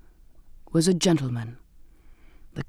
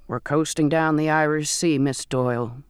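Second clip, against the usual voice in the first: raspy voice; 2 semitones lower than usual. Acted. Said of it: "his voice raspy".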